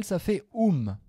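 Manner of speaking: ordinary talk, nothing marked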